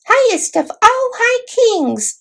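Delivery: very high, slow, courtly squeak; a tiny knight addressing his king with great ceremony; proud mouse knight. A narrator's reading. Voices Reepicheep the mouse.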